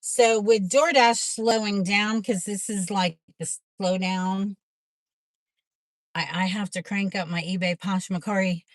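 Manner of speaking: normal talking voice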